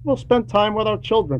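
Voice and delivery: ordinary talk, nothing marked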